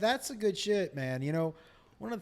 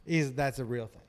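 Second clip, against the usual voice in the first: ordinary talk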